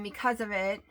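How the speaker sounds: ordinary delivery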